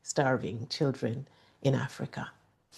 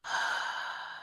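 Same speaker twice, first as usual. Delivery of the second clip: slow breathy sigh out; long audible exhale; 9 dB quieter than usual. Real, not acted. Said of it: "Let's breathe".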